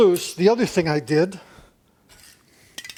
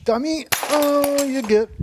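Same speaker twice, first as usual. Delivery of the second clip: self-scolding; 8 semitones higher, 3 dB louder, slower than usual. Real, not acted. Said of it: "No, not a crescent wrench".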